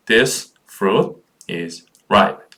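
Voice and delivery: "This fruit is ripe" with a clear pause after each word. Shown as a demonstration. Repeats the sentence word by word. (한 단어씩 끊어 읽기)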